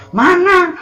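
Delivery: high-pitched mock demand; coarse demand. Shown as a demonstration. Preacher mimics a believer demanding results from God, coarse demand. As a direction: throw it upward as a rude, comic challenge to God. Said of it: "jangan sampai ke Allah kasar gitu".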